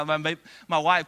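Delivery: ordinary delivery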